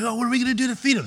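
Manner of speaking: high, fast worried disciple voice; hammed-up anxious follower; mock fretting disciple. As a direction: play a panicked apostle, fast and high. Apostles voiced in Bible retelling.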